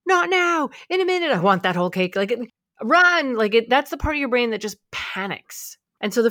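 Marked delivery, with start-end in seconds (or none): 0.1-2.2 put-on high, whiny child voice, then rushed; midbrain as a greedy kid. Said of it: "The midbrain's job is to freak out". 2.8-3.3 sudden high, loud alarm; panicked cry. Retold or imitated. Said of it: "The midbrain's job is to freak out".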